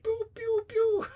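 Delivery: very high-pitched pew noises; imitated blaster shots. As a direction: fire off squeaky toy-gun shots. Imitates Goblin's weapons firing.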